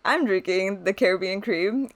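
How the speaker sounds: ordinary talk, nothing marked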